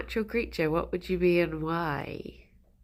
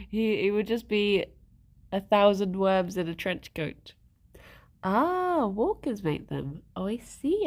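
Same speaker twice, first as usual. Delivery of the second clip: ordinary talk, nothing marked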